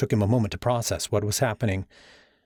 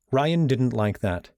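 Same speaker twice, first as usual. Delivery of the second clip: usual speaking voice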